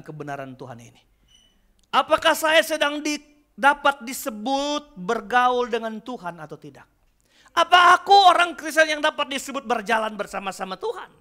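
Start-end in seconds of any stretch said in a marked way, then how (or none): 1.9-11.1 loud, high sermon over worship music; preaching over music. Acted. Pastor preaching to congregation over music, rising fervour.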